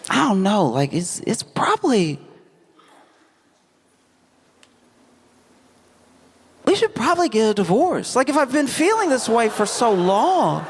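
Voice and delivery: high-pitched, halting acted confession; hesitant confiding daughter. Daughter confides marriage doubts to mom, hesitant high voice.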